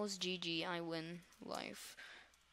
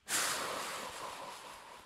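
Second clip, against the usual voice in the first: long sigh. Real, not acted. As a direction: breathe out a long upset sigh. Upset after being chased in game.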